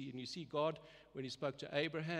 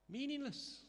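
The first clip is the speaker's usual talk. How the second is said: dismissive; 10 semitones higher than usual. Real, not acted. Preacher dismissing empty good works.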